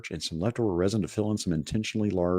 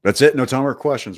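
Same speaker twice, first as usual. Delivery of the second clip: loud, higher jokey cutoff; mock abrupt sign-off; 6 semitones higher, 6 dB louder than usual. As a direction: slam the show shut.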